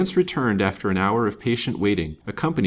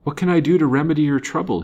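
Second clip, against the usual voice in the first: quick, high, louder offer of help; anxious chivalry, fast and pitched up; urgent concern; 8 semitones higher, 6 dB louder, faster than usual. A narrator's reading. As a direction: rush to offer a remedy.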